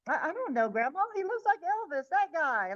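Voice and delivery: offhand kid, lightly voiced and high; no big deal. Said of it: "no big deal".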